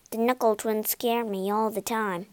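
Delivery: ordinary delivery, nothing marked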